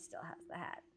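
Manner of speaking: unvoiced whispered aside. Real, not acted. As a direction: whisper it under your breath. Muttered aside.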